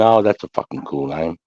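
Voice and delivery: ordinary talk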